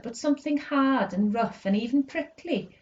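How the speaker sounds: ordinary delivery, nothing marked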